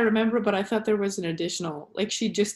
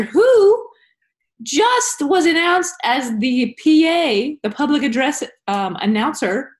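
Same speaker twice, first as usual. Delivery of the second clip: big, drawn-out PA announcer voice; louder, higher and slow, like a stadium announcer; booming it out over the PA; 7 semitones higher, 7 dB louder, slower than usual. Pretend.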